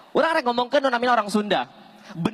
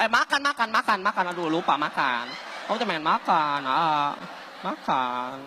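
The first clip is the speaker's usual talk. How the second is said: put-on girlfriend voice; 4 semitones lower, 4 dB quieter than usual. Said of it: "Awewe na ngomong".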